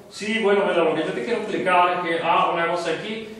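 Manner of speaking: swallowed, inward-pulled voice; muffled inward voice. Shown as a demonstration. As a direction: keep the sound back inside the throat. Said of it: "O más para adentro la voz".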